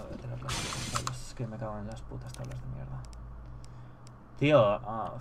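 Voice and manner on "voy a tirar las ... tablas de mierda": cursing under breath. Real, not acted. Frustrated over inventory space.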